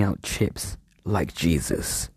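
hushed, secretive whisper. Acted. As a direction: whisper it like a confession. Hushed dramatic storytelling.

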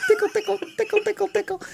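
high squeaky tickle voice; baby-talk tickling, way up high; giggly tickle attack. Pretend. Tickling the ball sack picture.

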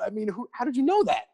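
high, astonished question; amazed disbelief. Retold or imitated. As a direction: marvel at how they knew. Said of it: "Like, do I".

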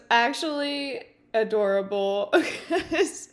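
real laugh, tickled by the memory; slow words tipping into laughter. Real, not acted. Laughs while recalling the rap.

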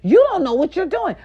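rising to a high loud yell; retold angry yell at the nurse; retold scolding. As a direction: tell her off, building up. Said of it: "So I'm like".

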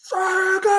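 drawn-out, held-back imitation of screaming; retold angry yell. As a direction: imitate an irate caller without full volume. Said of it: "O cara começou a gritar".

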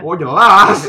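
laughing. Real, not acted. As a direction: agree while cracking up. Laughing agreement.

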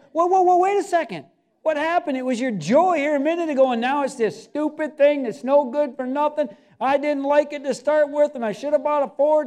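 high, loud mock disbelief at the fickle owner; preacher calling out the fickle owner, loud mock disbelief; incredulous. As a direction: throw up your hands at him.

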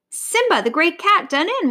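high-pitched stunned cry; astonished exclamation. A narrator's reading. Hunters seeing the great cat fallen, astonished exclamation. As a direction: exclaim in amazement.

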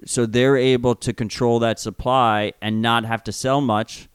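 ordinary talk

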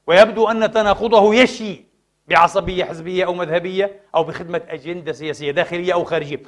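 ordinary delivery

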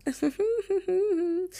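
high giggle. Real, not acted. Laughs after own joke.

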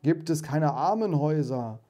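mocking echo. Ghost quoting Scrooge's heartless words back at him, cutting mockery. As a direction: throw his own words back at him. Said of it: "Scrooges eigene Worte gegen ihn gebrauchend".

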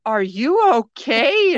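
imitating a worried manager, loudly stretched; retold alarmed check.

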